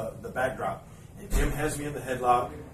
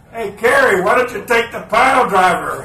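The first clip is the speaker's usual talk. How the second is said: much louder and higher, calling from the stands; heckler shout; 9 semitones higher, 16 dB louder than usual. Retold or imitated. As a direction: heckle him from the crowd. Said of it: "somebody in the crowd goes".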